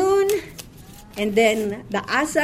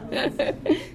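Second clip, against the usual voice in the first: amused laugh; 9 semitones higher, 4 dB quieter than usual. Real, not acted. Amused at the Egypt name.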